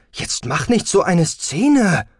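slow, loud, exasperated scolding; irritated. A narrator's reading. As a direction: snap back with drawn-out emphasis. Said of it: "versetzte Siela gereizt".

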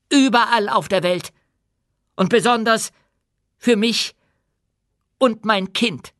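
proud, firm queen addressing her subjects; dwarf queen, commanding. Acted.

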